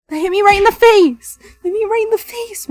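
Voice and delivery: high, loud yelp of protest. Acted. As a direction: yell it, mock-hurt. Hit in the face, yelping.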